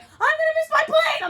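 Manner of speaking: very high-pitched frantic wail; acted freak-out. Daughter re-enacts begging everybody at security, high-pitched freak-out. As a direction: beg in an exaggerated high panic. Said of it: "I'm, like, freaking out".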